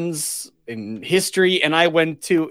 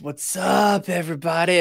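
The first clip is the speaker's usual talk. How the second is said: drawn-out stoner greeting; 3 semitones higher than usual. Shown as a demonstration. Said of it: "You gotta draw it out just a little bit more".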